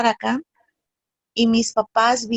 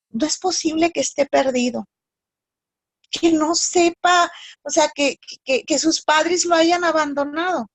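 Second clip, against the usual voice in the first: higher-pitched, halting distress; retold anguish, raised pitch with stumbling restarts; anguished, sad disbelief; 5 semitones higher than usual. Daughter tells her father about the abandoned boy, anguished. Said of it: "me dio una angustia y una tristeza tan grande".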